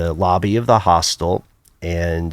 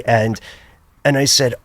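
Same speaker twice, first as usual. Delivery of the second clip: ordinary talk, nothing marked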